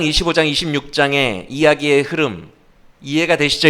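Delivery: ordinary talk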